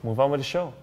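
ordinary talk

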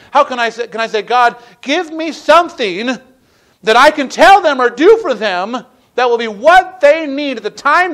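loud, high, pleading preacher voice; impassioned preaching. Acted. Preacher urging people to pray for others, impassioned preaching. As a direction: preach it out with rising fervor.

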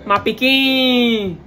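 slow Liberian "My pikin"; Liberian accent. Shown as a demonstration. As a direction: draw out pikin, Liberian style. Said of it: "Liberian accent".

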